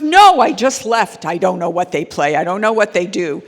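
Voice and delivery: flustered father. Retold or imitated. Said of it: "He goes".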